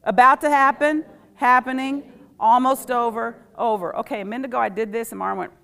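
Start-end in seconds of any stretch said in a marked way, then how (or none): 0.0-3.9 loud chant, fading at the end; call and response. Shown as a demonstration. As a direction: call it out again, then ease off. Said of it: "One more time".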